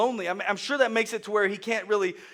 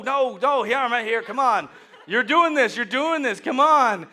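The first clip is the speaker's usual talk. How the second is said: loud, raised, eager coaxing; urgent encouragement; 6 semitones higher, 7 dB louder, slower than usual. Acted. God coaxing a stumbling believer onward, loud urgent encouragement. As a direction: cheer on a toddler's first steps.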